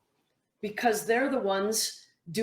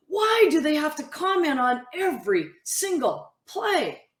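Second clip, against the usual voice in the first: exasperated athlete; 7 semitones higher than usual. Retold or imitated. Frustrated athlete quote.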